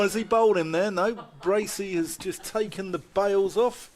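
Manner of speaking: ordinary talk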